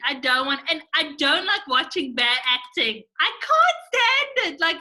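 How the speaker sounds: passionate rant. Real, not acted. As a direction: rant with growing exasperation. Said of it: "passionate, [name]".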